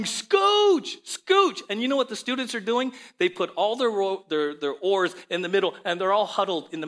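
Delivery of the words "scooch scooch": high, loud yelled order; guide yelling. Retold or imitated. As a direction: yell it over the river to a stuck raft. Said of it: "And their guide is yelling".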